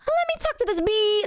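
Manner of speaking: high squeaky playful voice; silly high voice. Retold or imitated. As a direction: pitch way up, silly and light. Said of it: "I wanna smell like I'm like".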